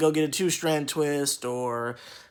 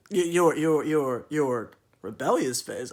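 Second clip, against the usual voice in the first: louder, stammering disbelief; incredulous; 2 semitones higher, 5 dB louder than usual. Retold or imitated. Him answering the rebellious phase remark, incredulous. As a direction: repeat it like you can't believe it. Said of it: "I'm just like".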